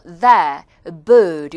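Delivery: "The, bird" with long vowels, no r; British long vowel. Shown as a demonstration. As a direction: stretch "The, bird" and drop the r. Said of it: "앞에가 조금 길죠?".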